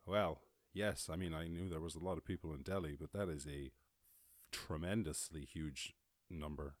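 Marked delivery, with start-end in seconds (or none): none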